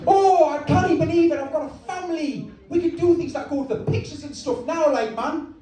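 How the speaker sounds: high, excited Geordie son; Luke Skywalker. Acted. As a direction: marvel at it in a hammed-up Geordie voice. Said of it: "That's why I made him Geordie".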